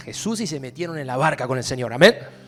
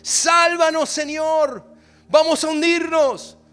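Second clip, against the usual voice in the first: loud, higher, urgent cry; preaching at full force; 6 semitones higher, 5 dB louder than usual. Acted. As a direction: make them hear the panic. Preacher retells the disciples' cry.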